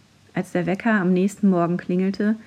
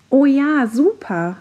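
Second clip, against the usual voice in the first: high, louder delighted shout; excited cheer; 6 semitones higher, 4 dB louder, slower than usual. A narrator's reading. Classmates cheering the coffee plan, excited. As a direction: cheer the idea. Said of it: "riefen alle".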